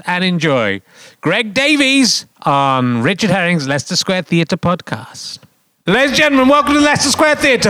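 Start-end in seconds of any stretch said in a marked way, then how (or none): 5.9-7.7 announcer. Acted. Announcer introducing the show.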